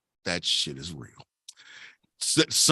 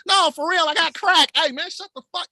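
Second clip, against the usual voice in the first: mock pleading dealer; 8 semitones higher than usual. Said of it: "everybody like".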